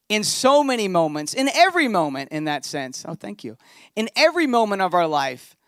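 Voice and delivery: loud, high preaching voice; full-force preaching. Acted. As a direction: preach with rising fervour to the congregation.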